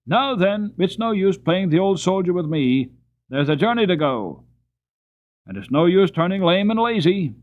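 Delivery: loud, high scolding; scolding driver. A narrator's reading. Driver scolding the lame horse loudly.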